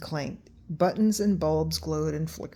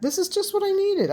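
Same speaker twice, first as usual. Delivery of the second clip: put-on much higher boyish delight; excited; 13 semitones higher than usual. A narrator's reading. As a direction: gush with delight. Matt delighted.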